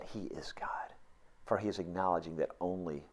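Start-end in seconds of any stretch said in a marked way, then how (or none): 0.0-0.9 whisper. Real, not acted. Preacher whispers the key point.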